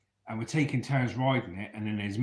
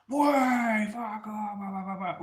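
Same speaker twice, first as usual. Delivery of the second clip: put-on drunk paratrooper; 10 semitones higher, slower than usual. Drunk paratrooper abusing kid.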